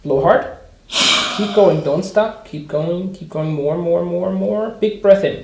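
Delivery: loud, urgent encouragement; loud coaching. Shown as a demonstration. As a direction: push them hard with your voice. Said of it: "providing the greatest possible encouragement".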